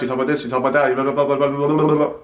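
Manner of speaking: rapid run-on babble; too fast. Shown as a demonstration. Showing viewers what talking too fast is like, rushed babble. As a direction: race through it into blah-blah babble. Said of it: "You talk too fast".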